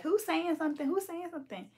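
mimicking sisters asking who said it; sisters' outrage. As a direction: squeal it as the offended sisters. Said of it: "her sisters is gonna be like".

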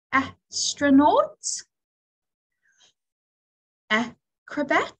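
astronaut and acrobat drawn out slowly, first sound stressed; slow example words. Shown as a demonstration. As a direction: stretch each word so the A stands out. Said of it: "So I'll go first".

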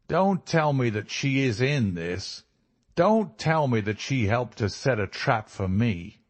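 American villain, cornered and sardonic; bitter American captive. Acted. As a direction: play the caught American, demanding answers. Captured American villain speaks.

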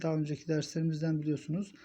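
ordinary talk

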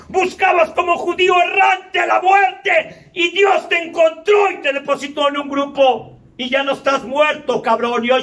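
fierce, shouted preaching; preaching at full force. Acted. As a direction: hammer it at them like a preacher. Preaching to the newcomer.

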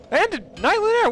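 very high excited exclamation; surprised. Real, not acted. Streamer finding yet another birthday, surprised. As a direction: shoot the pitch up in astonishment. More viewers have birthdays.

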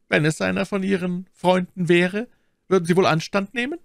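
high-pitched lady's challenge; Milady probing. Acted. Milady asks whether he would hesitate, high and challenging. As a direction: test his resolve in a lifted feminine voice.

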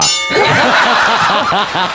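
sheepish laugh. Real, not acted. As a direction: laugh at giving in so fast. Laughs after giving in. (不好意思地笑)

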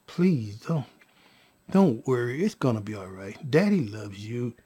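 low, soft reassurance; retold soothing. Father retells comforting his crying daughter, soft reassurance. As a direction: comfort a crying baby, keeping it low and gentle.

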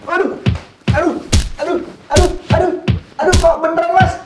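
high, loud cries of pain; pained yelling. Acted. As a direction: yelp as if really hit. Being beaten during fake shoot.

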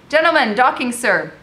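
high, loud military cry; calling out. A narrator's reading. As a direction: call the news across the dock. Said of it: "cried a soldier".

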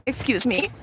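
usual speaking voice

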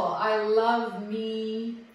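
very slow, tender "I love me" with "love" drawn out; slow self-affirmation. Shown as a demonstration. Yoga teacher models a compliment for the class, slow and tender. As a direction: give yourself the words gently, stretching "love". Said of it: "a beautiful compliment to yourself".

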